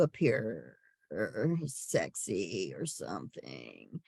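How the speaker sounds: very low, slow, quiet creak; mock vocal fry. Shown as a demonstration. Teacher mocking attempts to appear sexy, vocal fry. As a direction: stretch the words out and drop into deep fry.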